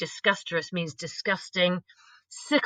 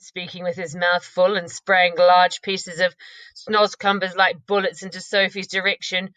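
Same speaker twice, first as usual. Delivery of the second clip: usual speaking voice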